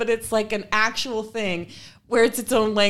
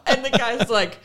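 normal talking voice

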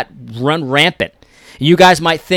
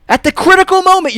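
urgent exclaim; 6 dB louder, faster than usual. Real, not acted. Heated appeal.

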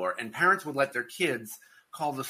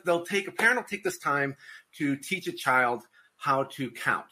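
ordinary talk, nothing marked

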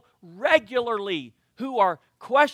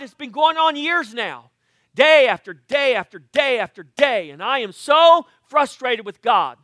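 loud, exasperated rant, higher and louder; mock frustration; 6 semitones higher, 10 dB louder than usual. Pastor acting out his frustration to the congregation, loud and exasperated. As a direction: vent like a man fed up for years.

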